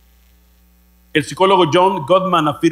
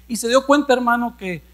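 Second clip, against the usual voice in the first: usual speaking voice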